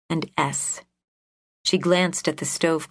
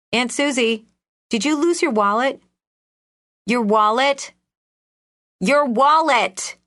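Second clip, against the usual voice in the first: calling loudly to a deaf aunt, higher; shouting; 7 semitones higher, 6 dB louder than usual. Acted. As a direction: raise your voice, slowing on the last. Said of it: "Iris could hear her shouting".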